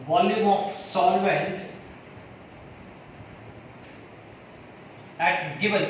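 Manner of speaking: slow, word-by-word dictation with long pauses. Acted. Teacher dictating a definition to students, slow and measured. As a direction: spell it out for the class to write down.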